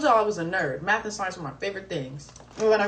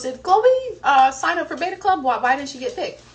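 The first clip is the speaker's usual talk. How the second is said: indignant mom; 6 semitones higher, 8 dB louder than usual. Retold or imitated. Mom questioning the teacher.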